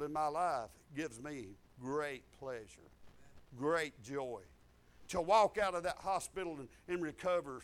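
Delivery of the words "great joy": normal talking voice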